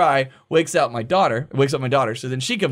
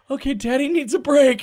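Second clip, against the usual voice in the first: high-pitched placating wife voice; 10 semitones higher than usual. Retold or imitated. Wife hears what happened, placating imitation. Wife soothing her frazzled husband.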